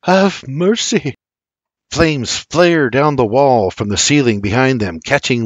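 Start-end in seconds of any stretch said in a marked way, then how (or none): 0.0-1.2 higher, slow mutter; slow, drawn-out murmured plea. Acted. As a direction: mutter it slowly at the sight of disaster.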